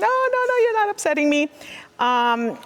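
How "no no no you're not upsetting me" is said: high, fast, rushed through tears; mild retold crying protest; tearful reassurance. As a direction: insist you're fine while sobbing. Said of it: "I was sobbing".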